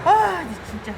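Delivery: high, loud exasperated outburst; worn-out cry at the endless herding; fed-up groan. Real, not acted. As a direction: blurt out pure frustration. Tired of herding beetle.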